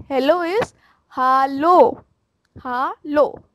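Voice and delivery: slow, drawn-out hallo with an open a; slow pronunciation demo. Shown as a demonstration. Teacher repeating the greeting, slow and clear. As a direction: stretch each syllable for learners. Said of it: "Again, I am repeating".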